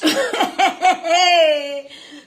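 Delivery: loud laugh; real laughing retort; loud taunting laugh. Real, not acted. Laughs back at the other speaker.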